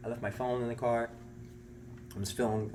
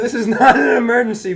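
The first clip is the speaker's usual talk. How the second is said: laughing embarrassed; 11 semitones higher, 15 dB louder than usual. Retold or imitated. Telling 911 it's no emergency.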